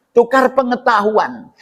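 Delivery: ordinary talk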